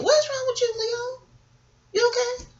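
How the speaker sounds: high, soft coo to a pet; talking to the cat. Real, not acted. Vlogger checking on her cat Leo, high soft coo.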